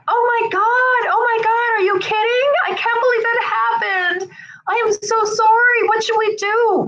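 high-pitched, hammed-up distress; overwrought sympathy. Shown as a demonstration. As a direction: overreact as if it happened to you. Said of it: "I'm being empathetic to them, but I'm not".

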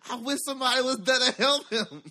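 high, laughing fretful voice; high, nervous whine; high helpless whine. Retold or imitated. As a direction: whine it like a scared onlooker. Helpless bystander.

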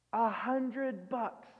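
A hundred bucks stretched slowly, each word weighed; drawn out slowly. Shown as a demonstration. Preacher slowly repeating the tiny debt for contrast. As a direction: linger on A hundred bucks to mock the small sum.

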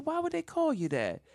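high-pitched puzzled grandma question; feigned innocence. Retold or imitated. Grandmother questioning her grandson, high-pitched feigned innocence. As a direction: act as if you have no idea. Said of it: "instant lie".

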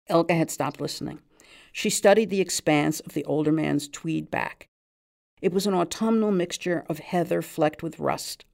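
usual speaking voice

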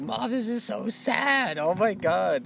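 high, drawn-out wail to mom; hammed-up tearful whine, stretched and high; mock crying. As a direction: imitate a kid crying to mom. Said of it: "crying in my mom's arms".

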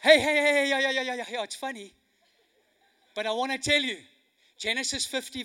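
ordinary talk

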